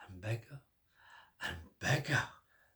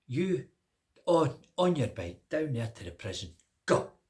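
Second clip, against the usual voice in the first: commanding king; 7 dB louder than usual. Acted. King orders him away.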